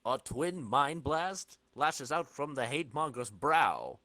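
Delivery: ordinary talk, nothing marked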